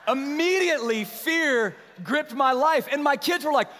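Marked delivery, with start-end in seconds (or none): none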